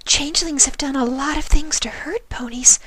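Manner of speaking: high, breathy whisper of a timid pony; gentle, shy, barely daring to ask; Fluttershy's voice. A narrator's reading. Fluttershy explains danger.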